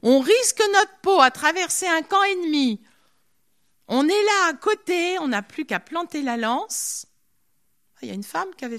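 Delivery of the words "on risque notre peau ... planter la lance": louder, higher complaint from a nervous companion; protestation indignée. Acted. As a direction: protest loudly, pitch jumping up. Companions protest mid-raid. (protestation exaspérée, plus forte et aiguë)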